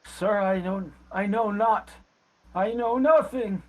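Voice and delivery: high, halting denial; old beggar pleading. Acted. Beaten old man tells questioner he knows nothing, pleading. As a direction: plead ignorance, pitch climbing. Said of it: "Uh, and he says".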